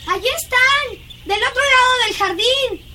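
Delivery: put-on high, excited child's call; excited spotting. Girl showing governess Miles across the garden, excited. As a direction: point them out eagerly.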